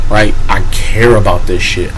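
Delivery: ordinary delivery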